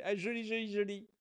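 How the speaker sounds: a hint of an African French accent; imitating an African accent. The filmer admiring the pigeon, in a light African accent. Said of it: "il a un accent africain".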